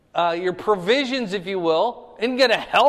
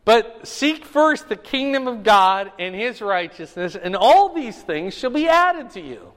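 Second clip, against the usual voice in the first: scripture reading; 4 semitones higher, 6 dB louder than usual. Acted. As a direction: proclaim the verses to the room. Reads scripture aloud to class.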